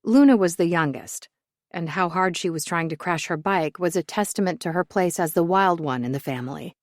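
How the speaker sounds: ordinary delivery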